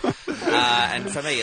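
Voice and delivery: ordinary talk, nothing marked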